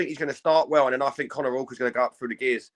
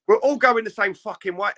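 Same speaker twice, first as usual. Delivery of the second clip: higher, louder exasperated inner rant; mock outrage; 8 semitones higher, 5 dB louder than usual. Fed up with stair queue jumpers, a mock angry rant. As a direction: vent it like a fed-up commuter. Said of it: "And I think".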